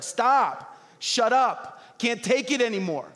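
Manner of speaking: mock irritated shout; fed-up outburst. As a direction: beg them to stop, annoyed. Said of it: "everybody around you is like".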